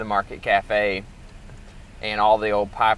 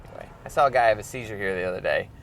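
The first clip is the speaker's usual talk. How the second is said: normal talking voice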